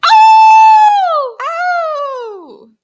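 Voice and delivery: high loud yelping howl. Acted. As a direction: let out a wild howl. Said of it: "Like that?".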